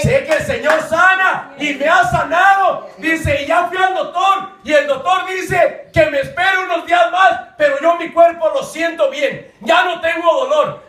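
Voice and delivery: high, forceful sermon proclamation; loud, fervent retelling; preached testimony. Retold or imitated. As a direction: shout the healing out to the congregation.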